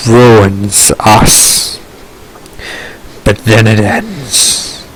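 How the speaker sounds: low, slow, solemn storyteller; dramatic story narration. Acted. Narrator tells of the storm's ruin, low and solemn. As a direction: narrate it low with long, heavy pauses.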